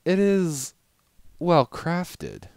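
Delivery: high, loud grand lady conceding; haughty lady voice. Acted. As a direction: grant it grudgingly, nose in air. Rarity voiced, grudging praise.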